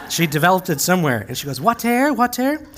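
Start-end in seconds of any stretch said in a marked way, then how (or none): 1.6-2.7 high, questioning mock French accent; Penny's French accent. Preacher showing how Penny asks to water, high French accent. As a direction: lift each word sharply upward, French-accented. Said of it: "she has a French accent".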